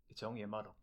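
very soft, low-key aside; muttered aside. Acted. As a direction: drop it under your breath. Deflating aside quoted from film.

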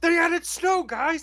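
high, bright excitement; excited. Real, not acted. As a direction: announce it with glee. Excited announcement of snow.

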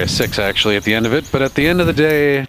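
ordinary delivery

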